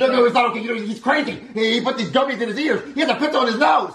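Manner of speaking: high-pitched, panicky protest; frantic. Acted. Panicked rant about crazy child.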